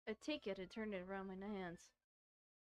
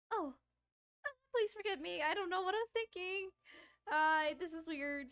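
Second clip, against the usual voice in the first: put-on much higher, louder flustered girl's voice; flustered Yuri; 9 semitones higher, 7 dB louder than usual. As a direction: blurt an apology in a panic. Yuri flustered after licking.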